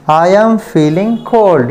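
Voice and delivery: slow, deliberate model of the mistake; slow wrong example. Shown as a demonstration. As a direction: show the wrong form slowly. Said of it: "ये हो गया wrong". (धीरे-धीरे गलत उदाहरण)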